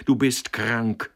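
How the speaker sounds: loud, high urging; fierce, insistent outburst; wild cry. A narrator's reading. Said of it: "rief sie wild".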